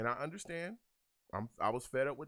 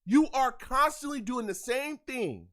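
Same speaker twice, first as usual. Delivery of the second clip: frustrated yelling; 8 semitones higher, 6 dB louder than usual. Real, not acted. Fan yelling at player on screen.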